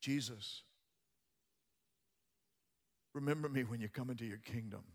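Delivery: soft, low appeal to Jesus; personal plea. Retold or imitated. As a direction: plead quietly, pausing after the name. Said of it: "talks to Jesus personally".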